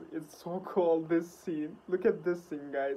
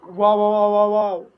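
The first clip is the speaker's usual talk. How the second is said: shouting wow over and over, much louder; loud amazed wows; 11 dB louder than usual. Real, not acted. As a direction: belt out the wows in awe. Amazed reaction to video scene.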